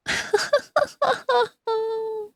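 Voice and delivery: high, breathless fit of real laughter; breathless laughing fit. Real, not acted. Streamer cracking up over the novel, breathless laughing fit. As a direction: laugh until the breath runs out. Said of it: "Wait, let me catch my breath".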